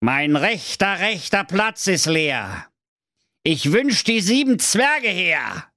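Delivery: slow, high incantation; magic spell. Acted. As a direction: chant the summoning. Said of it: "allerlei alberne magische Bewegungen".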